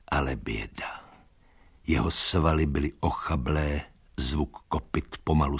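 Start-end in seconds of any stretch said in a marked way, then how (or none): 0.1-1.0 hushed, grieving whisper. A narrator's reading. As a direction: breathe it out in sorrow. Narrator laments.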